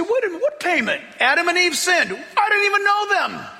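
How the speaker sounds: high, laughing disbelief; hammed-up protest, voice jumping way up; mock incredulous. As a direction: play the objector who can't believe it. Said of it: "You say".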